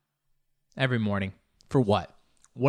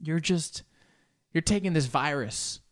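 ordinary delivery

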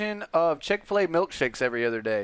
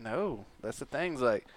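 ordinary talk

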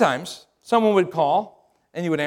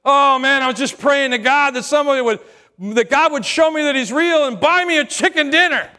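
higher, louder overjoyed stranger; excited stranger; 8 semitones higher, 5 dB louder than usual. Retold or imitated. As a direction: gush with surprised gratitude. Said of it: "the guy says".

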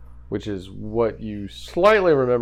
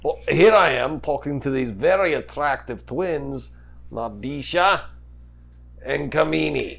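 easygoing Oliver character; 4 semitones higher, 4 dB louder than usual. Acted. Game master plays Oliver.

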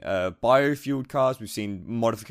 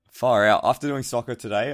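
ordinary talk

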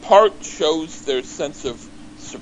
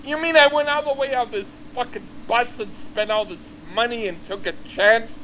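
mock-angry griping, pitched up; retold anger; 8 semitones higher, faster than usual. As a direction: imitate a rejected man's outraged complaint. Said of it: "And he got really angry".

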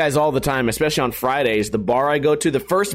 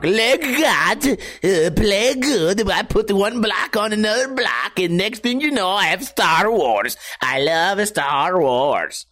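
a hint of a Danish accent, slow at the end; mock Danish accent; 5 semitones higher, slower than usual. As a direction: lightly fake a Danish toy maker. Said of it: "Do I sound Danish?".

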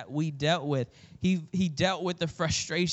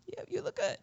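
small, high, squeezed-out praise; half-hearted compliment; 8 dB quieter than usual. Pretend. Grudgingly praising your sister after a sigh, half-hearted compliment. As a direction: force it out softly in a high voice.

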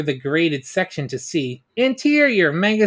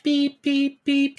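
high repeated alarm beeps; higher beep-beep-beep of a red alert; imitated alarm beeps; 10 semitones higher, slower than usual. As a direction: mimic an alarm going off. Alarm sounds.

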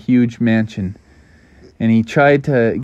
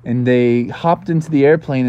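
plain reading voice

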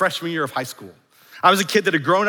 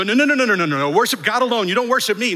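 higher, louder hammed-up refusal; fast, raised voice, brushing off worship; mock protest; 5 semitones higher, 4 dB louder, faster than usual. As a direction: play it as a hurried, loud mock objection. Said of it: "Jesus doesn't go".

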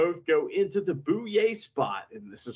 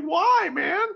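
high complaining why; 13 semitones higher, 4 dB louder than usual. Retold or imitated. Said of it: "And Hiromu's like".

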